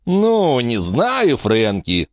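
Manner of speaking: high start sliding down; drawled. A narrator's reading. Dogface doubting Frankie, drawled reply. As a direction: stretch the words out doubtfully. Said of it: "протянул он".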